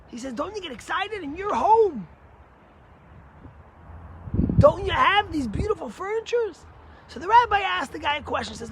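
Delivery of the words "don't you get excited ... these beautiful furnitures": louder, pressing, a bit higher; frustrated rich man. Retold or imitated. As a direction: push the question at the rabbi. Frustrated donor challenges the rabbi.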